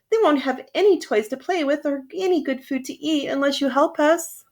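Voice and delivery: high begging chorus of toys; pleading toys. Acted. Toys begging the shiny engine, high pleading voice. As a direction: beg the engine sweetly. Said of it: "all the dolls and toys cried out".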